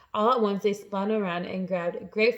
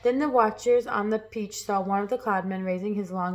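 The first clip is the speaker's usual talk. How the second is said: plain reading voice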